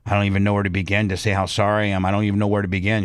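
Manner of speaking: fast, low, sheepish apology; hurried low apology to the wife of the man he hit; rushed apology. Retold or imitated. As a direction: rush the words out in embarrassment. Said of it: "And I go".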